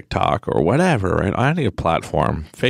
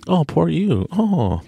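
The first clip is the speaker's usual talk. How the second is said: higher, sarcastic pity; mock sympathy; 11 semitones higher, slower than usual. Speaker mocks a self-styled victim, in fake sympathy.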